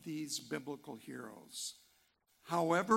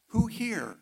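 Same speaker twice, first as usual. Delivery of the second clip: "Who here" called out loud and high; calling out; 6 semitones higher, 9 dB louder than usual. Acted. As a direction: ring out "Who" to the whole room. Repeated rhetorical question, louder.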